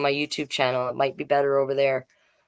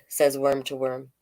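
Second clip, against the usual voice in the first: plain reading voice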